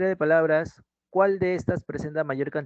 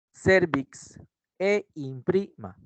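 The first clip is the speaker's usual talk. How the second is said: slow cervix e imprima, stressing CER and PRI; stress on second-last syllable; 4 semitones higher, much slower than usual. Shown as a demonstration. Teacher listing grave words, cervix e imprima slowly stressed on CER, PRI. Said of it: "Ellas son las palabras graves".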